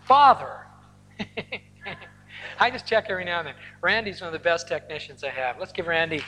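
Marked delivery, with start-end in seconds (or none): 0.0-0.7 raised, louder cry to God; re-enacted cry, pitched up and projected. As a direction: call out as a man praying aloud. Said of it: "cried out".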